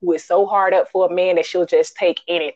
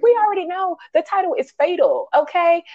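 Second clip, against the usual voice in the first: exasperated rant; 8 semitones higher than usual. Retold or imitated. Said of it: "But I'm like".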